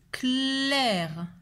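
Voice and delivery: "clair" with the L held long; held L sound. Shown as a demonstration. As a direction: draw out the L inside "clair". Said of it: "le son L". (« clair » en allongeant bien le son L)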